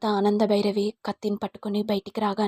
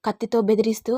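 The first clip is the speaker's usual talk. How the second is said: plain reading voice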